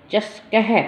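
चषकह with a breathy अह at the end; visarga sound. Shown as a demonstration. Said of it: "अह की आवाज़ नहीं आती".

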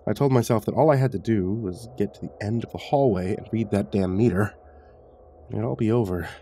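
usual speaking voice

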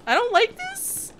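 loud, high, drawn-out protest; frightened whine. Real, not acted. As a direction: whine it high and slow, scared. Scared player in dark asylum.

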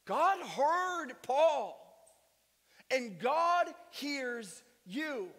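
loud rousing sermon cry; full-force preaching. Acted. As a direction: preach it out to the whole room, rising.

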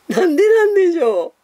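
high, loud puzzled wail. Real, not acted. Streamer answering why they talk Kanto, loud puzzled wail. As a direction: pitch it way up, playfully at a loss.

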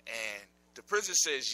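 ordinary delivery

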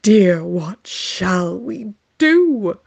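hammed-up wail; mock wail. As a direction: wail it in despair.

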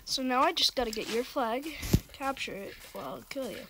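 talking while chewing; words round a wad of gum. Real, not acted. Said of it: "only thing you guys will probably hear right now is chewing".

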